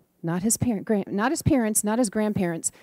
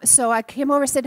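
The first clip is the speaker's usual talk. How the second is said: ordinary talk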